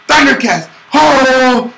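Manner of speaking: hammed-up kid's Thundercats cry; Thundercats battle cry. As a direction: shout it like a cartoon hero calling his powers. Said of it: "as soon as I said".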